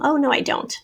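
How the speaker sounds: ordinary talk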